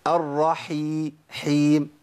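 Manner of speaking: slow chanted الرحي، حيم with clear ح; sustained, carefully articulated ح in حيم; audible ح rasp. Shown as a demonstration. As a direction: draw out حيم after a breathy throat ح. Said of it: "ودي اسمها بحة الحاء".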